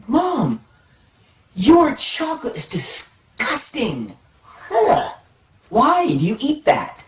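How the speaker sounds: put-on disgusted kid. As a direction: pitch it up like a kid who just tasted something awful and complains to mom. Kid complaining to mom.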